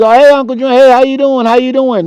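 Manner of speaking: high, eager fake-friendly hello; nervous greeting. Neighbor kid nervously greeting feared Uncle Junior, eager and high. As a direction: suck up to the scary uncle.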